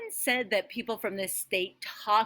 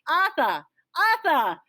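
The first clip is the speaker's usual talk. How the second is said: parents calling out Arthur with the R's dropped, higher and louder; Rhode Island calling out; 8 semitones higher, 4 dB louder than usual. Retold or imitated. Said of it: "no one in your family ever says their R's".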